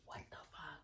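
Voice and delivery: barely audible whisper, no voice; whispered disbelief. Retold or imitated. Daughter to boyfriend as he walks in, whispered disbelief. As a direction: mouth it to him in a tiny whisper. Repeats it under breath to boyfriend.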